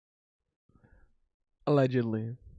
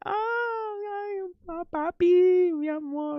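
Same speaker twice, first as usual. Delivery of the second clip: much higher falsetto, drawn-out "papi"; mock the women's swooning voice, high and slow; imitating flirty gym women; much slower than usual. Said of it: "They're like".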